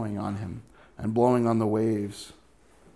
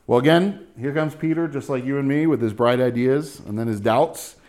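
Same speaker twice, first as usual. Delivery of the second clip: ordinary talk, nothing marked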